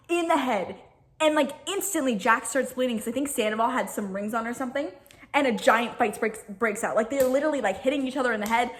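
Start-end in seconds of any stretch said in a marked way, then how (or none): none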